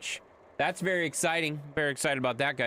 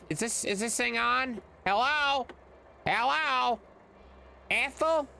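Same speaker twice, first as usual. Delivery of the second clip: high hollow calling out; calling from far away; 9 semitones higher, slower than usual. Pretend. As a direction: call out as if into the distance. Pretend calling into the void.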